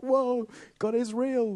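high, loud, slow awestruck whoa; completely shocked. Retold or imitated. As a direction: exclaim it in stretched-out amazement. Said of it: "We're, like, completely shocked, completely like".

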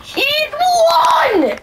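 very high, loud outraged yell; disbelieving shout. Real, not acted. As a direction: scream it, stunned he won. Opponent wins the match.

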